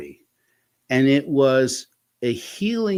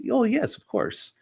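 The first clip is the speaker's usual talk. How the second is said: eager clients; 13 semitones higher than usual. Retold or imitated. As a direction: voice jumped high, chirpy and eager. Clients answering the therapist.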